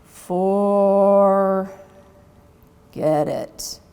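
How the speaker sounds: a drawn-out tired groan, then the words trail off; groaned answer. A narrator's reading. Exhausted sloths groaning the sum at Sparky. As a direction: moan the answer as if worn out. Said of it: "The answer came in a groan".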